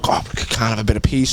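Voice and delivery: normal talking voice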